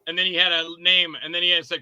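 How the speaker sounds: ordinary talk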